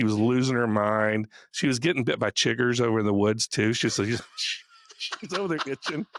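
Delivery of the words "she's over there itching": words breaking into laughter; laughing. Real, not acted. Host laughs about the chigger-bitten coach while telling it. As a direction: giggle through the line, softer.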